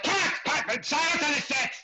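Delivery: high, carrying mock set call. PA to cast and crew, calling out. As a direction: imitate a PA yelling across the set. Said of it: "P A calls out".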